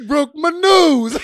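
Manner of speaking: movie quote impression. Quoting The Longest Yard guy, hammed-up whine. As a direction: wail it like the big guy in the movie. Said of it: "that guy in The Longest Yard".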